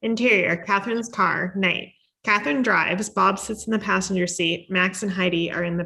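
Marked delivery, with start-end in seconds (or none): none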